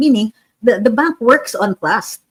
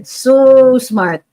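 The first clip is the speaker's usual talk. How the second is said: sarcastic; 4 semitones higher, 6 dB louder than usual. Real, not acted. Sarcastic praise of commenters.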